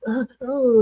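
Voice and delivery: high mock referee flustered; hammed-up prancing referee, pitched high; fussy little referee. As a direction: imitate a flustered little ref.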